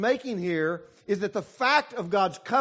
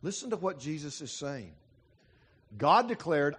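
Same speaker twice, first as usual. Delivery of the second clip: usual speaking voice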